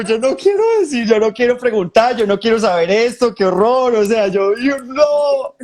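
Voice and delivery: high, loud panicky protest; frantic high "I don't want to know"; panicked refusal. Retold or imitated.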